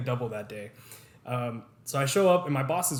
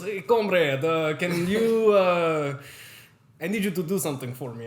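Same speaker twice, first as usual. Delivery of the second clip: imitating a Russian boss, louder; Russian accent; 3 semitones higher, 4 dB louder than usual. Russian boss asking the new guy a favor, Russian accent. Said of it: "applaud you for that Russian accent".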